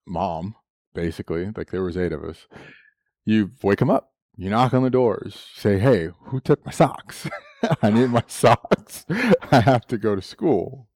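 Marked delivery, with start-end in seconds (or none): 5.8-10.9 laughing through a mock demand; half-laughing kid's complaint, higher; a teen laughing as he begs for his socks; laughing plea.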